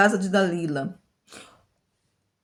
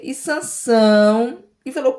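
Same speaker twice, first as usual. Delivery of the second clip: ordinary talk, nothing marked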